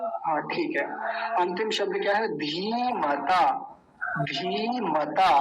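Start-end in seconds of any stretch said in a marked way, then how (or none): 2.3-5.4 धीमता with a long drawn-out धी, very slow. Shown as a demonstration. Teacher drawing out धीमता's long vowel for students. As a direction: stretch the ee in धीमता. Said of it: "बड़ी की मात्रा है, लंबा खींचेंगे".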